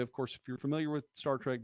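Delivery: ordinary delivery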